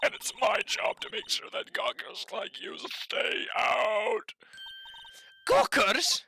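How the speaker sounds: lightly voiced old busybody whisper; old lady voice. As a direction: hiss it like a nosy old woman. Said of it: "I'm not giving her that, that voice".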